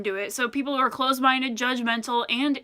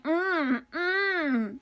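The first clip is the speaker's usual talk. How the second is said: drawn-out pleasured "mmm", pitched higher; mock eating moan; 4 semitones higher, 4 dB quieter than usual. Complaining about people who moan while eating, mock moan. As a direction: imitate someone moaning over their food. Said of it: "I don't want you to sound like".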